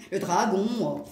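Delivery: drawn-out mock Franche-Comté accent. Joking about how they talk in Franche-Comté, put-on regional accent. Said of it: "s'il parle comme ça en Franche-Comté".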